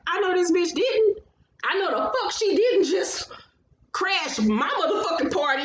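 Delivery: acted incredulous indignation; mock outrage. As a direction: imitate someone affronted by a gatecrasher. Voices Melody's outraged look.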